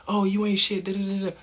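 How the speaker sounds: mock yelling wife. Mimicking the wife scolding her husband, high and mocking. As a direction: squeal out the insult like a nagging wife, in mockery. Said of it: "They want you to be like".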